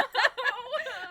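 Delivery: loud high burst of laughter. Real, not acted. Laughs picturing New York accent.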